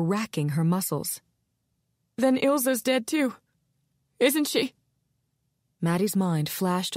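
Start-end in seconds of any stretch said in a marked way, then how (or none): 2.2-4.7 higher, anxious question; dreading the answer; frightened woman asking about her sister, anxious; fearful. Acted. Ilona fearing for sister.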